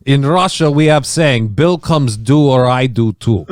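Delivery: thick mock Russian accent; slow, heavy Russian hitman.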